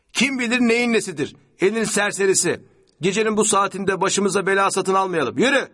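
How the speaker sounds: high, loud, impatient rant; scolding. A narrator's reading. Passenger scolds driver over wounded stranger, impatiently. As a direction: berate the driver and order him on. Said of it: "şoförüne çıkıştı".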